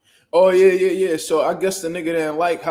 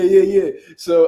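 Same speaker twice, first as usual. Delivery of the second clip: normal talking voice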